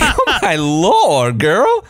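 high, slow shocked drawl; Southern accent. Retold or imitated. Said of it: "And I was like".

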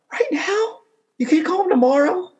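very high-pitched squeak of disbelief; mock incredulous. Asking her why she must call now, mock incredulous and high. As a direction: shoot the questions way up high, incredulous.

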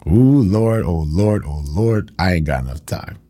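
preacher fired up, pulling back; exclaiming aside. Real, not acted. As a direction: sigh it out to yourself.

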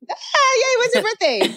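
high, delighted squeal; excited squeal. Real, not acted. Singer squeals to the host who is also a Taurus, excited and high. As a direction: squeal with joy at a fellow Taurus.

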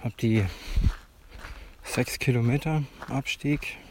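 talking mid-run, a bit winded; while running. Real, not acted. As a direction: speak between running strides. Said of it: "Ich lauf gerade in Ettlingen ein".

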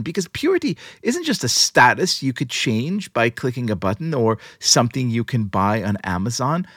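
usual speaking voice

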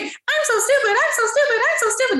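very high, squeaky cartoon mouse voice; Mickey Mouse. Seminar attendee saying a limiting belief, in a Mickey Mouse voice. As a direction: chant it in a silly falsetto. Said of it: "say in a Mickey Mouse voice".

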